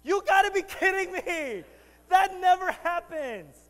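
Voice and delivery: high, loud disbelieving yell; scoffing guys shout. Pretend. Preacher voices boyfriends scoffing at movies, shouting. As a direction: blast it like a fed-up boyfriend.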